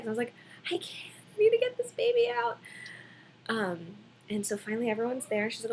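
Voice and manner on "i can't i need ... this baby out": high, loud pleading cry; high, louder desperate retold cry; desperate yell. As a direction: shout it out like a mother mid-push. Retelling her desperate reply in labour.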